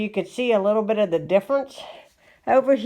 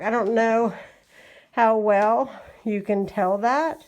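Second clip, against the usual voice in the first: normal talking voice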